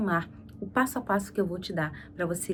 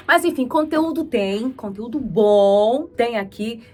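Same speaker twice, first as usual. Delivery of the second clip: excited promo; 3 semitones higher, 9 dB louder, slower than usual. Real, not acted. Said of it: "promoting channel content".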